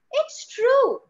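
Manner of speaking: put-on high insistent child's cry; pleading child. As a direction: plead like a child who isn't believed. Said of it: "voice modulation from mummy to child".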